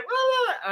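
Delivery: high-pitched comic blubbering; imitated noise. Retelling the cop's noise to friends, imitated noise. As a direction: toss off the silly noise in imitation.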